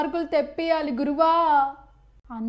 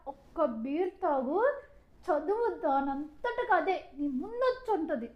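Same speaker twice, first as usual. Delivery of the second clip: softer, slow tempting coax; sly coaxing; 7 dB quieter than usual. Acted. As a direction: lure her into one beer. Roommate tempting her to drink.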